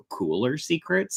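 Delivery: ordinary delivery, nothing marked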